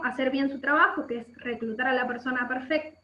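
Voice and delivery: ordinary talk, nothing marked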